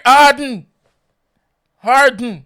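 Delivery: loud, high "Harden" ending on N; emphatic demonstration. Shown as a demonstration. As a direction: drive the word home, loudly. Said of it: "You know, hear it?".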